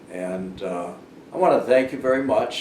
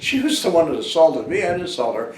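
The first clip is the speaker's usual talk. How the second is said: louder, higher, insisting on innocence; indignant protest; 6 semitones higher, 5 dB louder than usual. Retold or imitated. Retelling denying assault to the husband, indignant and loud. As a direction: push back hard, it was her.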